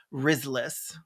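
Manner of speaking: normal talking voice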